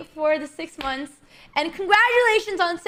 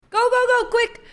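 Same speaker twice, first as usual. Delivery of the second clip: frantic high rush; hurry, almost yelling; urgent shout; 8 semitones higher, 4 dB louder than usual. Real, not acted. Urgent time scramble in bullet.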